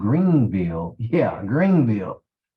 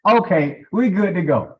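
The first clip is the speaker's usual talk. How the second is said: excited; 9 semitones higher, 5 dB louder than usual. Retold or imitated. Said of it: "I'm like".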